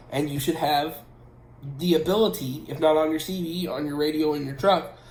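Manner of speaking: ordinary delivery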